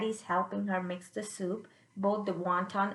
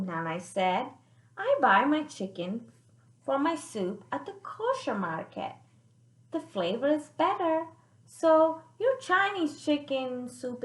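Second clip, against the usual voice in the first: put-on lively read-aloud for kids; expressive children's story reading; storybook narration; 3 dB louder than usual. A narrator's reading. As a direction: read it out like a picture book to children. Reads the picture book aloud.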